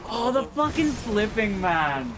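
loud, drawn-out Canadian exclamation; Canadian accent. Real, not acted. Player cursing mid-game as the Canadian comes out, Canadian accent. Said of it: "This is the Canadian".

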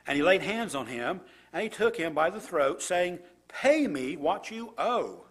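scripture reading. Acted. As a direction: read the passage out to the congregation with weight. Reads scripture passage aloud.

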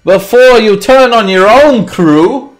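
high, booming, slow theatrical shout; villain captain yelling. Acted. Enemy captain taunting a rival ship, yelled out. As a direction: bellow a gloating taunt across the water. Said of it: "he kinda just yells out".

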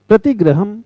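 normal talking voice

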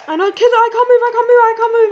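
high, loud, repetitive wail; urgent high-pitched complaint; loud protest. Real, not acted. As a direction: cry out high in frustration. Stuck in game, protesting.

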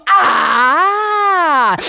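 high loud witchy cry; witch's cry. Acted. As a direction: let out a startled witch shriek. Witch's sound.

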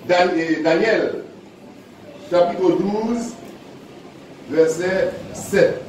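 slow, spaced-out reading of the reference "Daniel, chapitre douze, verset sept"; slow dictation. Acted. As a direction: spell out "Daniel, chapitre douze, verset sept" slowly so people can find it. Announces reference slowly.

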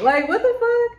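very high-pitched bewilderment; playful disbelief. Acted. Reader mocking own excited talking, playful high disbelief. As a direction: pitch it way up, comically baffled. Repeats own odd voice, amused.

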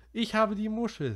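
ordinary delivery

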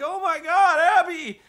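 high, loud frightened shout; alarmed family cry. Retold or imitated. Family woken by dog fighting.

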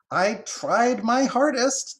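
high, loud, drawn-out squirrel voice; earnest squirrel. Acted. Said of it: "holding both front paws to her heart in affirmation".

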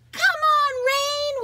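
loud high joyful shout. A narrator's reading. Girls shouting at the pouring rain, loud and high. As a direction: yell it to the sky in glee. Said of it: "we shout".

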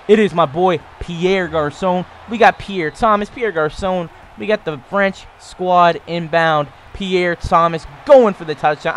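loud, hyped, drawn-out celebration; excited touchdown call. Real, not acted. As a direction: shout out the scoring play.

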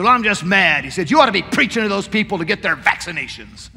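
mock outburst of a grumbling old man; angry old man. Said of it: "he was just filled with anger".

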